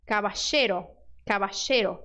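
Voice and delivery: caballero said cabashero, LL as SH. Shown as a demonstration. Teacher showing the Argentine SH option. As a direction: caballero with a rioplatense SH. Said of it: "som de S H".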